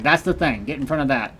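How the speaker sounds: normal talking voice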